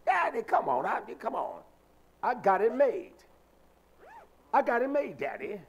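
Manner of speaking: loud, high mock pleading with a parent; mimicked cocky child. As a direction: imitate a kid waving off daddy.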